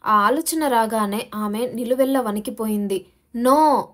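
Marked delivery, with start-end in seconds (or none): 3.4-3.9 desperate loud scream of no; trapped woman screaming, much louder. A narrator's reading. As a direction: scream it in sheer terror. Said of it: "అని గట్టిగా అరవసాగింది".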